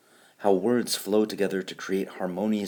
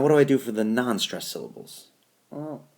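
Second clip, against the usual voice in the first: usual speaking voice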